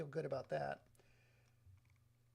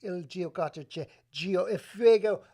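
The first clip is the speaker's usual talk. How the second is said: put-on Italian accent; 10 dB louder than usual. Streamer hamming up on-screen Italian for viewers, loud Italian accent. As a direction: belt out the Italian, slowing and swelling louder. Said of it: "I can make that accent".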